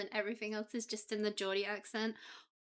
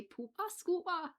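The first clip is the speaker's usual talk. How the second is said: higher, slow, broad Geordie vowels; Geordie accent; 8 semitones higher, slower than usual. Retold or imitated. Copying Jimmy Carr's Geordie lesson in a Geordie accent. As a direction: stretch it out the way Jimmy Carr taught it. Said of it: "Jimmy Carr taught us all how to say".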